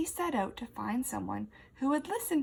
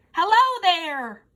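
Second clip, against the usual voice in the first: loud, higher booming hello; 7 semitones higher, 11 dB louder than usual. Acted. Effie greets the beetle in a booming voice. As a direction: thunder out a friendly hello. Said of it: "Effie's voice was like thunder".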